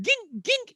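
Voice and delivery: high-pitched "gink" noise; imitated impact clink. Shown as a demonstration. Showing friends how a baseball hit sounds, high imitated clink. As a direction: mimic a ball hitting a glove in high pings. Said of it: "I could go gink".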